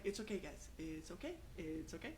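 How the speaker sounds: quietly settling a nervous crowd; soothing reassurance. Retold or imitated. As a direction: hush them gently: it's okay.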